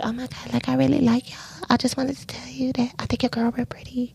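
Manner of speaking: shy gushing whisper. Acted. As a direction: whisper it like a timid admirer. Voicing shy girls' gushing.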